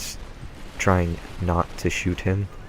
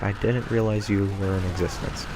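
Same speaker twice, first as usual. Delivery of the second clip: ordinary delivery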